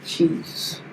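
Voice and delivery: breathy whispered word. Pretend. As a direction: whisper it low. Whispered back and forth.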